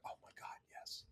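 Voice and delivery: whispered starstruck acceptance; retold awed whisper. Teenager invited to his idol's studio, retold in an awed whisper. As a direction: whisper it as if overwhelmed, acting it out.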